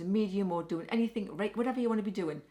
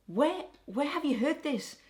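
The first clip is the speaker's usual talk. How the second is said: exasperated; 6 semitones higher, slower than usual. Acted. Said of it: "you wanna shake them and say".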